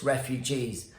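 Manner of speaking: ordinary talk, nothing marked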